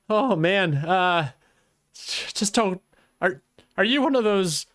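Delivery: a lightly voiced nervous guard, rambling in fear; a hint of a scared lookout caught alone; frightened guard.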